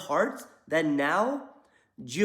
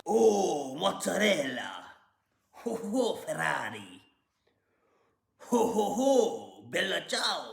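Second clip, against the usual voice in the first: a mock Italian accent, lightly put on and slowed; bad Italian accent; 3 semitones lower, slower than usual. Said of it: "I can't do an Italian accent".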